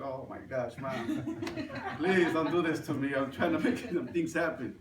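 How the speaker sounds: laughing through the words to mom; laughing plea. Retold or imitated. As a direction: plead with mom while chuckling.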